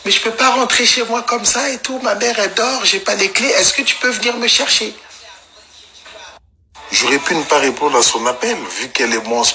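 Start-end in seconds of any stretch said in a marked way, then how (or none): 0.0-4.9 very high whiny begging voice; high squeaky parody of her phone call; mock pleading girl. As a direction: plead like a tipsy girl asking for a ride. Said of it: "elle m'appelle".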